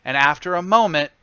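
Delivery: ordinary talk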